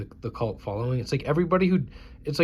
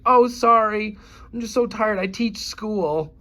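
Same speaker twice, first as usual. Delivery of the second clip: higher, whiny apology; mock cashier; 7 semitones higher, 5 dB louder, slower than usual. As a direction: mimic a weary excuse. Imitating the tired cashier.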